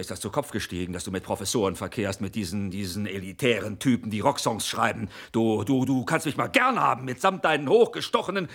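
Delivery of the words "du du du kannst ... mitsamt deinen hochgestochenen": loud, rising higher with each insult; angry yelling. Acted. Husband bellowing insults at his wife, angry yell. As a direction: spit the insults louder and higher. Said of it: "mich hier so anzubrüllen".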